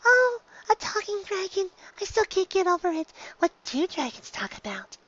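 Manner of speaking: high, soft, eager rambling; shy pony opening up to a dragon; Fluttershy chattering. A narrator's reading. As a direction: chatter softly with growing delight.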